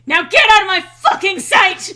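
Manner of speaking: high, very loud mock scream. Eggman screaming in the baby's face, a hammed-up scream. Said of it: "screaming in the baby's face".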